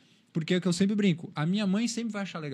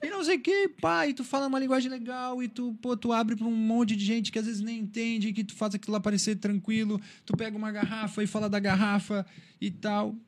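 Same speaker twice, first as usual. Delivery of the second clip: high-pitched imitation of admiring shop staff; high mock-excited crowd voice, easing down gradually; excited fans; 6 semitones higher than usual. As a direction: gush in a raised voice, playfully mimicking fans. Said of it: "o pessoal da Shimano me olhou".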